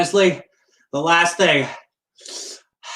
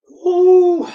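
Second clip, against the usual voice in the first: sharp high yelp of pain; pained cry; 14 semitones higher, 6 dB louder than usual. Real, not acted. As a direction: yelp out at the burn.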